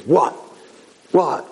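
imitating an angry kid's protest. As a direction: play an argumentative kid firing back. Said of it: "He's angry".

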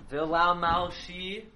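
V'la Malshi drawn out slowly, the common wrong way; slow, stretched V'la Malshi as people say it; common mispronunciation. Shown as a demonstration. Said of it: "you'll hear everyone say".

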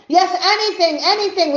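high, eager yes; acted eagerness, pitch way up; eager agreement. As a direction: jump at the offer. Climber eagerly agrees to anything.

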